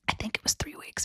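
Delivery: whispered aside, half laughing; a giggly whispered correction. Real, not acted. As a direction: slip in the correction under the breath.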